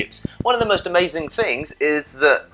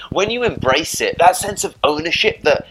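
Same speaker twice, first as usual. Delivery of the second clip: ordinary delivery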